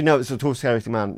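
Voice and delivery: ordinary talk, nothing marked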